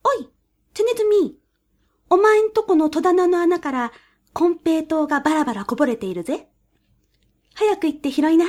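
quick, high sly weasel voice; fast-talking weasel. A narrator's reading. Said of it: "早口に言いました".

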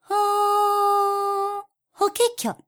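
high, sing-song bird call; warbler call. Acted. Warbler sings from next tree.

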